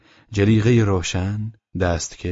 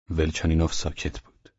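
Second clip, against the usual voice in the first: ordinary delivery, nothing marked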